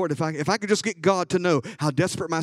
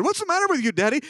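daughter's startled question; 11 semitones higher, faster than usual. Retold or imitated. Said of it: "She said".

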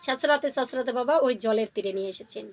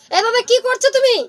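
high, loud call to big brother; impatient yell; 11 semitones higher, 8 dB louder than usual. Acted. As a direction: shout it up, itching to eat. Impatient younger jackal calls brother.

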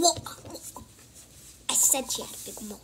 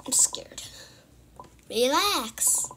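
ordinary delivery

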